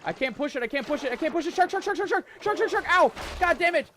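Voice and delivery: very high, loud shark panic; panicked screaming. Real, not acted. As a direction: scream it out in terror. Shark attacking the raft.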